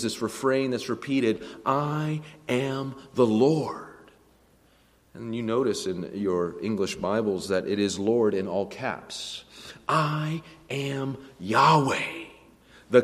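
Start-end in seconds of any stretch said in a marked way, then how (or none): 1.6-4.1 "I am the Lord" in slow, separated words; emphatic refrain. Shown as a demonstration. Preacher pointing out God's refrain, "I am the Lord" slowly emphatic. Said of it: "Notice the emphasis throughout God's sermon". 9.9-12.2 "I am Yahweh" very slow, each word held; emphatic refrain. Shown as a demonstration. Preacher revealing the divine name, "I am Yahweh" slowly emphatic. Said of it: "Notice the emphasis throughout God's sermon".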